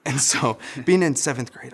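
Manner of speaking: normal talking voice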